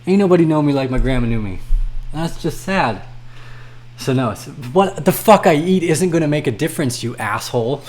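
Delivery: usual speaking voice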